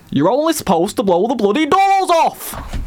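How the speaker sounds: high, slow shouted Cockney quote; Michael Caine. Acted. Host testing co-hosts with a film quote, high shouted Cockney. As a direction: bellow it slowly in a put-on Cockney accent. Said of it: "I'm thinking Michael Caine".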